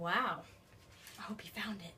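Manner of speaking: plain reading voice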